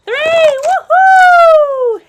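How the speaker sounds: loud, high whooping yell; excited shout. Acted. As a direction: shout it out racing down the slide. Countdown then slide down.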